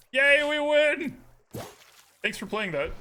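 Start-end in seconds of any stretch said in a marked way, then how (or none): 0.1-1.2 high, loud, drawn-out cheer of victory; excited cheer. Real, not acted. Streamer cheering the match win for chat, high and loud.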